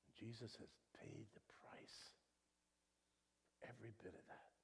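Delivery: soft whispered reverence; preacher hushing the congregation; hushed whisper. Acted. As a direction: lean in and whisper it.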